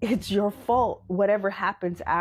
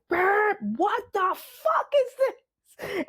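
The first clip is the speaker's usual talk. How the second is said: squeaky, much higher, slow startled voice; high, slowed, bewildered what-the-hell; panicked inner voice; 11 semitones higher, much slower than usual. Retold or imitated. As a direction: play the body's alarmed little voice, drawn out. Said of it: "when it is like".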